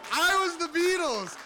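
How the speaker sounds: very high, ringing boast; exultant cry. Real, not acted. Storyteller reliving his summer of fame, exultant. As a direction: proclaim it in full glory. Repeats climactic boast, then winds down.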